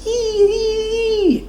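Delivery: very high, thin squeal; tiny piercing animal squeaks; thinnest hare trill. A narrator's reading. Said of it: "the hare thrilled the thinnest".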